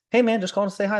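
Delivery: his own awkward call. Retold or imitated. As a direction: play his forced friendly greeting. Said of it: "it's like".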